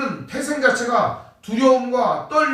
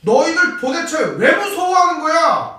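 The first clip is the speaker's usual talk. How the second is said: higher, louder, slow scolding; scolding rebuke; 7 semitones higher, 7 dB louder than usual. Retold or imitated. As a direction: rebuke them sharply, drawn out. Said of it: "꾸짖고 있는 겁니다". (제자들을 꾸짖는 예수님, 높고 크게 천천히 꾸짖는 말투)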